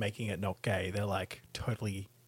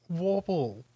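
mocking; 7 semitones higher than usual. Real, not acted. Mocking the word.

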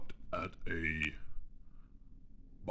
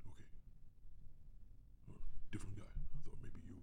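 normal talking voice

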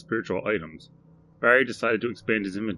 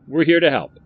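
louder, higher proud motto; the shopkeeper proudly recites his motto, louder and higher; Barry proudly repeating; 8 semitones higher, 10 dB louder than usual. Acted. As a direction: belt out the motto like a salesman. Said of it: "said Barry".

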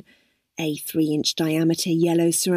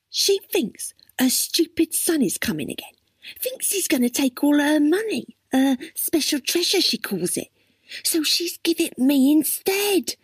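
high-pitched, impatient explaining; exasperated girl character; 9 semitones higher than usual. Acted. Crystal explains the old woman's treasure to Dwayne, exasperated. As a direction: explain it pitched well up, fed up with having to spell it out.

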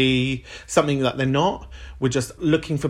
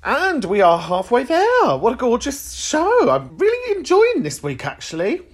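upbeat presenter link; 10 semitones higher than usual. Real, not acted. Host's bright sign-off.

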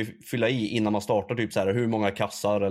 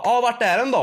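high, louder mock cashier voice; mimicked cashier; 11 semitones higher, 5 dB louder than usual. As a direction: put on the bossy shop clerk. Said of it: "Hon bara".

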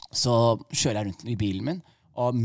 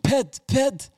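high-pitched call for attention; acted-out calling to a passenger; 12 semitones higher than usual. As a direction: call the name sharply to someone not listening. Calling distracted boss while driving.

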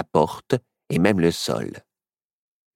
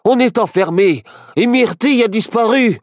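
high, urgent calling out; excited, high-pitched cries; agitated; 6 dB louder than usual. A narrator's reading. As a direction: wake a friend in alarm, voice raised high. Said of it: "s'excita Barnabé".

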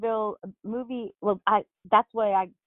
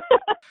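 high, loud burst of laughter; short high laugh; 7 dB louder than usual. Real, not acted. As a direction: burst out laughing at your own remark. Laughs at own dating remark.

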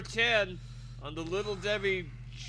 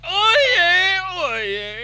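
high, loud town crier cry; court crier call; 16 dB louder than usual. Pretend. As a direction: bellow like a bailiff opening court. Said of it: "Did you just say".

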